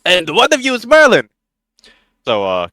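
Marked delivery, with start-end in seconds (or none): 0.0-1.3 put-on booming, high Russian-accented character voice; stout foreign newcomer. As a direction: greet strangers in a high-pitched Slavic accent. Kostar addresses the group.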